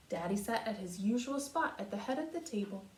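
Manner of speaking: ordinary delivery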